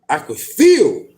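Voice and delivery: normal talking voice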